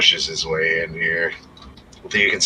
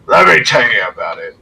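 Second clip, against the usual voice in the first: put-on boastful drunk; 9 semitones higher, 7 dB louder than usual. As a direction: crow about past glory, half shouting. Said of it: "he's like".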